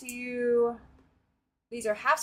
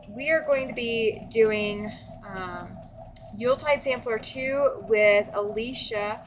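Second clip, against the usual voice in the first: ordinary talk, nothing marked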